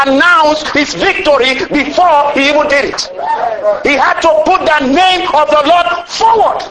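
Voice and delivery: preaching shout. Real, not acted. Preacher proclaiming God's name before the congregation, full shout. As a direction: drive it home with a shout.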